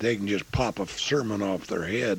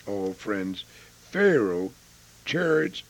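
ordinary delivery

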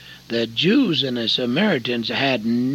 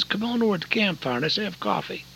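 Darrell's invitation; 8 semitones higher, faster than usual. Retold or imitated. Darrell voiced at dawn.

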